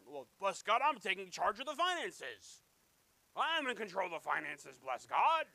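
loud, blustering take-charge proclamation; pompous, punched-out boast; mock self-important declaration. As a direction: puff it up like a domineering boss. Mimics man boasting of control.

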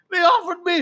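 high excited quoting voice; high excited retold quote; retelling the guy. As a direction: pitch up and call it out. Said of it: "He's like".

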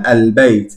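al-beit with a clearly audible L; pronounced lam. Shown as a demonstration. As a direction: sound the L in "al-beit". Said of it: "¿Notáis esa lam?". ("al-beit" haciendo oír la lam)

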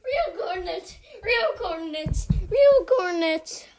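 loud laughing wail; manic shouting. Real, not acted. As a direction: yell it half laughing, unhinged.

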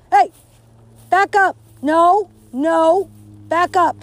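sudden, very loud, high shout; shouting at the dog. Real, not acted. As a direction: yell at the dog to back off. Yelling at the dog.

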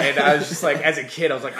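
usual speaking voice

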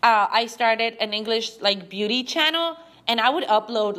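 ordinary delivery

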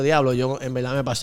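low, soft self-reproach; soft regretful aside. Retold or imitated. Telling friends he regretted it, soft low aside. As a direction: quietly admit you went too far. Remorse after she cried.

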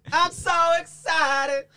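very high, slow squeal; mock giddy excitement. Guest hams up joy over a new album, giddy squeal. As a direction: squeal it out in exaggerated falsetto.

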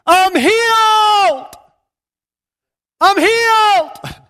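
high, loud, slow cry of joy from a rooftop; joyful shout. Retold or imitated. Healed woman on her rooftop shouts to the village, high and loud. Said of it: "she starts shouting".

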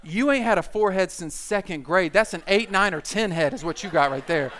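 loud, mocking pile-on; roast session. Guys roasting their buddy about his forehead, mocking. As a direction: ride over him with big punchy jabs. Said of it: "Roast session begins immediately".

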